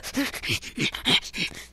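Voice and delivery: breathy, stuttering giggle; nervous laugh. Real, not acted. Gamer jittery after a scare, nervous breathy laugh.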